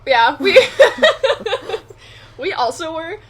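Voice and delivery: laughing while talking; high, loud laughing. Real, not acted. Laughing together about jumping him.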